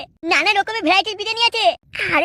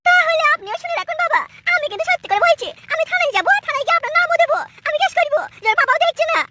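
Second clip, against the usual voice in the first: defiant daughter-in-law; 9 semitones higher than usual. Acted. Daughter-in-law threatens to name her father-in-law to the police, high and defiant. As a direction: threaten him in a high raised voice.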